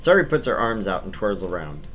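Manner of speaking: normal talking voice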